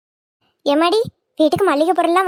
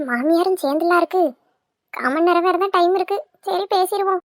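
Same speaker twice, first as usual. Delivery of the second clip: ordinary delivery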